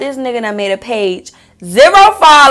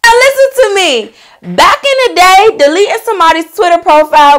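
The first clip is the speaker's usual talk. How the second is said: emphatic; 7 semitones higher, 4 dB louder than usual. Real, not acted. Emphatic aside to viewers.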